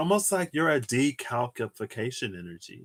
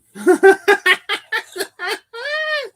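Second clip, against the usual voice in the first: a sudden high, loud burst of laughter; 9 dB louder than usual. Real, not acted. Amused at her own wandering mind, high laugh.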